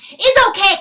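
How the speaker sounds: high loud weasel voice; cried. Acted. Weasel calling to the stag, high excited voice. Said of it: "cried the weasel".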